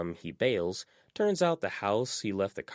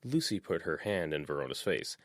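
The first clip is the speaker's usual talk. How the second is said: ordinary delivery, nothing marked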